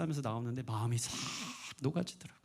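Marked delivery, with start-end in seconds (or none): none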